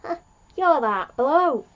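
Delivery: recognising surprise. Retold or imitated. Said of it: "Graham sees the boss guy and says".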